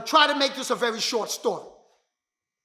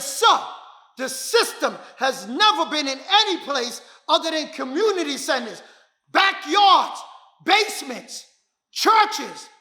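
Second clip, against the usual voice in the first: passionate pitch; 3 semitones higher than usual. Retold or imitated. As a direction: sell the dream with rising fire. Pleading his dream to mentor.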